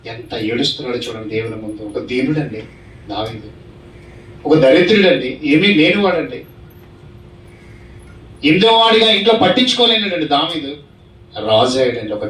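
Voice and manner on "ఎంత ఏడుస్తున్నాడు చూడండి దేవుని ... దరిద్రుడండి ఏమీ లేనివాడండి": ordinary talk, nothing marked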